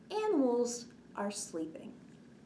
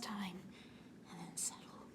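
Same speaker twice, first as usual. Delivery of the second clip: whisper; 4 semitones lower, 16 dB quieter than usual. Acted. Librarian whispering kids to settle like sleeping birds. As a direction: whisper fading to almost nothing.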